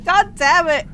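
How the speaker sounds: loud, high frustrated swearing; angry curse. Real, not acted. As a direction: swear hard at the miss. Raging at the game.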